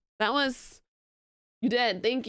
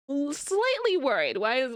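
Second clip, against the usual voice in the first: ordinary talk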